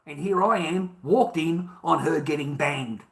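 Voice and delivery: ordinary delivery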